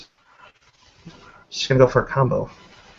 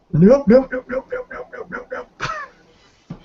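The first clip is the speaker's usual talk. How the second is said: very high squeal, fading away; squeaky fleeing nopes; 4 dB louder, slower than usual. Pretend. Player mimics her character running away, squeaky and fading. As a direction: start loud, climb higher and fade into the distance.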